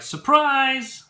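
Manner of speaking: high loud "Surprise!" burst; spring the surprise on the crowd; excited surprise. Retold or imitated. Cast announces surprise screening, loud and excited. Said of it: "And they were like".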